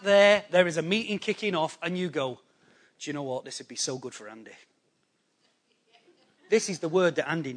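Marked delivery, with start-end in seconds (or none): 3.0-4.5 low, soft acted inner thought; quiet musing aside. Someone in the meeting thinks of absent Andy, quiet aside. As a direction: muse it softly, as if to yourself.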